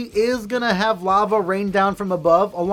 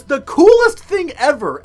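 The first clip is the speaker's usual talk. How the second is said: excited; 8 semitones higher, 8 dB louder than usual. Real, not acted. Gushing about favorite course.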